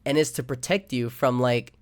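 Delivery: ordinary delivery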